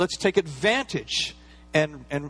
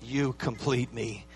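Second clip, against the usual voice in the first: tender movie line; 4 semitones lower, 6 dB quieter, slower than usual. Retold or imitated. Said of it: "Tom Cruise says to Renee Zellweger".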